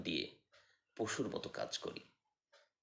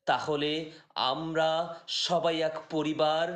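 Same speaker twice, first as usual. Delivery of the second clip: raised, projected Bengali poetry recitation; poem recitation; 4 semitones higher, 7 dB louder than usual. Acted. Reciting a poem that questions humankind, declamatory poetry voice. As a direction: recite in measured phrases, higher and louder than chat.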